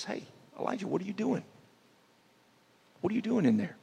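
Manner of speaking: high, hushed call into the cave; God calling out. Retold or imitated.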